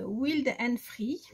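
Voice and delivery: slow, heavily French-accented English title. Real, not acted. Reading an English pad title to viewers, slow French-accented English. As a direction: read the English name slowly with a thick French accent. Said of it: "avec mon accent toujours aussi merveilleux en anglais".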